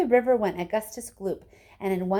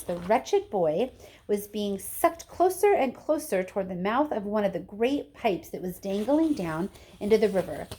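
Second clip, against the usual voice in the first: ordinary delivery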